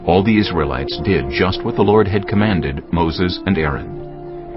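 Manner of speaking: ordinary talk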